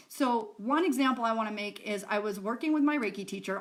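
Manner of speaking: usual speaking voice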